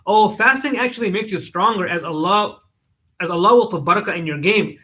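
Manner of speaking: plain reading voice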